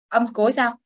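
ordinary talk

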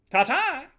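high, loud sign-off; called-out farewell. Real, not acted. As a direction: sing out a cheery goodbye. Signing off to viewers.

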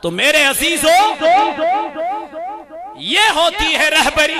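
loud, high shouted storytelling; fiery sermon climax; preaching at full force. Acted. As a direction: thunder out the saint's victory over the devil.

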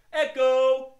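very loud, high echo call. Shown as a demonstration. Host practises the echo part with kids, loud call. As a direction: belt out the lead call. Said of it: "All right, let's practice".

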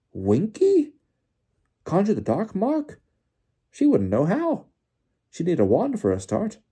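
high, loud, swooping questions; Bagman incredulous. A narrator's reading. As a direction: squawk in utter disbelief. Bagman cannot believe the accusation.

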